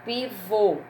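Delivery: Pivô with a closed final O; Pivô ending on a held, closed O; closed final vowel. Shown as a demonstration. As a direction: round off the last vowel of Pivô. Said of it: "som fechado".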